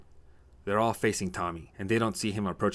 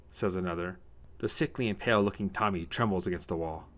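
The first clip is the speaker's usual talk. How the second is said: usual speaking voice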